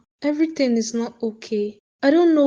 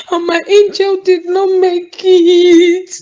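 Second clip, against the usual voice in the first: loud, tearful words through sobs; crying while speaking; 5 semitones higher, 9 dB louder than usual. Acted. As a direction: sob out the words. Mother tells of child's death.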